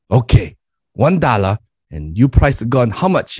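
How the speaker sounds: put-on lightly accented broken English of an immigrant dad; Korean dad accent. Dad instructs son to price crackers, lightly accented. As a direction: play the dad giving his son blunt orders.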